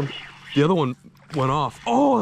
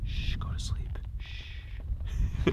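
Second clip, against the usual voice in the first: soft whisper to the fish; gentle whispered lullaby shush; 10 dB quieter, much slower than usual. Real, not acted. Calming the fish in hand.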